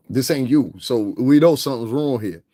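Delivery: normal talking voice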